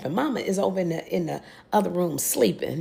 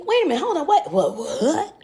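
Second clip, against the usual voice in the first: higher and louder, real shock breaking into a laugh; can't believe what she's hearing, stammering what; incredulous what what what; stunned disbelief; 7 semitones higher, 5 dB louder than usual. Real, not acted. Shocked at the proposal.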